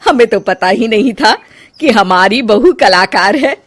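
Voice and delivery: put-on loud, thrilled older woman admiring; mother-in-law discovering her daughter-in-law is an artist; delighted mother-in-law. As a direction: gush over the paintings.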